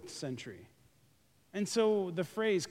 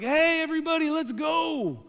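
excited call; 9 semitones higher, 4 dB louder than usual. Retold or imitated. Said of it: "and Jesus is like".

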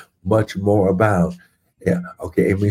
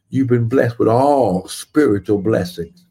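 ordinary delivery

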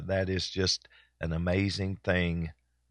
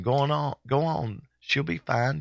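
a higher, lightly voiced teacher shooing him off; mock teacher brushing off grandpa's worry; imitating the teacher; 7 semitones higher than usual. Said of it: "I remember the teacher saying".

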